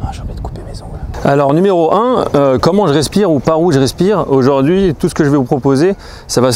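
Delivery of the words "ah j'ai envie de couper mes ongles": soft unvoiced whisper. Real, not acted. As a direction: whisper it so the dragonfly stays. Aside while dragonfly sits on foot.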